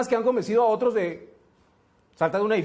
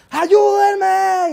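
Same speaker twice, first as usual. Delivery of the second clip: high, loud echoed plea; echoing cry for help; 10 semitones higher, 8 dB louder than usual. Retold or imitated. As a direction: call it across the canyon. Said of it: "escucha un eco". (eco agudo y fuerte pidiendo ayuda)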